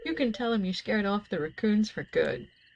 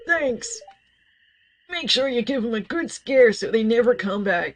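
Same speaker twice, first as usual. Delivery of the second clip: put-on higher, louder old farmer voice; George voice; 3 semitones higher, 6 dB louder than usual. Player redoes George's line in his old man voice. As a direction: boom it out like a gruff old man asking a favor. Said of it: "Sorry, I'm not doing my George voice".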